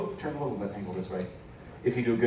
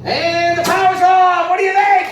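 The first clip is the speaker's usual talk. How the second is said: showman shout; 10 dB louder than usual. Acted.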